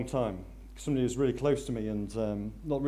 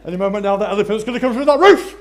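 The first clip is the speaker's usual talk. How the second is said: higher, louder, fast laughing delivery; preacher laughing at his own joke; 7 semitones higher, 15 dB louder, much faster than usual. Real, not acted. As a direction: laugh through the punchline. Joking about elephant through roof.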